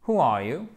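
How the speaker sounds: "who are you" with sounded H, silent W; bonne prononciation. Shown as a demonstration. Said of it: "Avec une intonation descendante". (« who are you » avec H prononcé)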